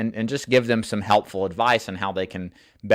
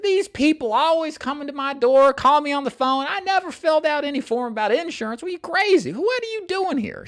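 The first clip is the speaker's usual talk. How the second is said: high, indignant rant in a put-on lady voice; mocking an angry homeowner. Lady at the door scolding the insurance agent, high indignant put-on voice. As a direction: imitate a fed-up woman scolding a salesman. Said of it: "She was mad".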